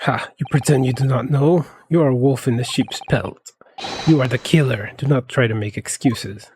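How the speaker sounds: lightly put-on Russian crewman accent; menacing sailor with a mild Russian accent; a hint of a Russian accent, accusing. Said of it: "They had Russian accents".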